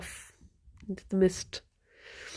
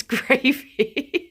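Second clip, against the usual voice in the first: imitated accent, dissolving into laughter; Booth's accent; 3 semitones higher than usual. [name] doing an accent on one word, laughing imitation. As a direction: mimic someone putting on another's accent, then crack up. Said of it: "doing Booth's accent".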